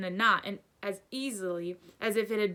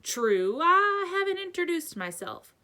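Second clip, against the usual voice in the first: louder, higher giant voice; amused giant; 9 semitones higher, 6 dB louder than usual. A narrator's reading. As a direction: chuckle through the introduction. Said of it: "The giant chuckled".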